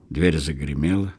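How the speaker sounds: plain reading voice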